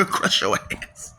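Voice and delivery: soft whispered menace; whispered threat. Acted. Whispering a playful threat at the viewer. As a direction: breathe the threat out.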